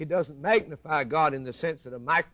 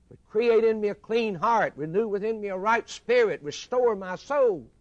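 louder and higher, pleading from the pulpit; fervent loud plea; 7 semitones higher, 6 dB louder than usual. Acted. Preacher voicing the sinner's prayer to God, fervent loud plea. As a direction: cry it out like a prayer for mercy.

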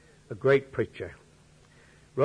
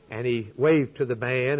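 ordinary talk

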